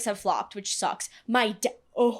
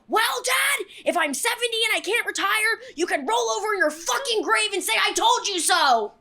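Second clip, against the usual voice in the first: retold yelling; 10 semitones higher, 4 dB louder than usual. Yelling at dad on phone.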